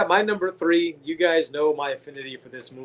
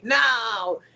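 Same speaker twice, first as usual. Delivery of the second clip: imitating Loomis yelling; 6 semitones higher than usual. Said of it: "When he's yelling".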